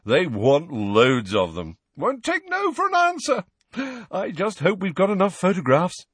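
showy boast leaping high; vain celebrity. A narrator's reading. Patient telling healer about his fans, exuberantly boastful. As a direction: brag with delighted self-importance.

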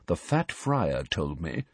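low secretive murmur; conspiratorial hush. Acted. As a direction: drop the voice to share a secret. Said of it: "He dropped his voice conspiratorially".

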